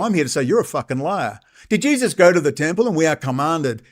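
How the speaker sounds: ordinary talk, nothing marked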